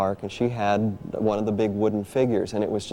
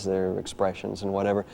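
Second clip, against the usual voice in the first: ordinary talk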